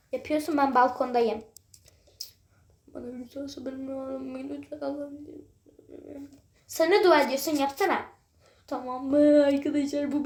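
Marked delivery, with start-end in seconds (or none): 2.9-5.3 soft hammed-up prayer; mock prayer. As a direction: pray quietly in mock despair. Balkonda dua ediyormuş gibi. (alaycı sessiz dua)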